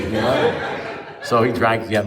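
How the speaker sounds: normal talking voice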